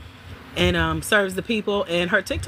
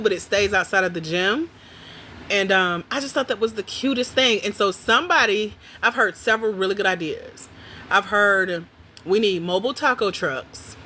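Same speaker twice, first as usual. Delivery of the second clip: usual speaking voice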